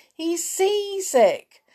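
high, drawn-out squeaky jeer; loud singsong taunt; mocking toy mouse. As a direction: tease in a high little mouse voice. Toy mouse jeers at Sailor.

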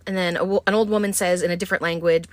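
ordinary talk

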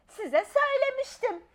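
put-on high childish voice, smug; told-you-so child. A narrator's reading. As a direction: tell them she was right all along. Child proven right. (haklı çıkmış, keyifli küçük kız)

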